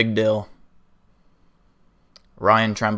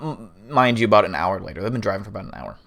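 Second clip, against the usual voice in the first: ordinary delivery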